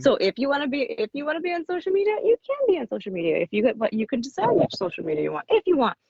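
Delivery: high, fast, breezy voice for the publisher; mimicking the publisher. Author imitating her publisher, high and quick. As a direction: rattle it off lightly as the publisher would. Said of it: "They were like".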